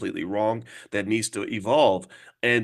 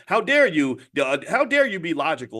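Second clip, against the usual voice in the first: mock outrage; 7 semitones higher than usual. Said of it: "They're like".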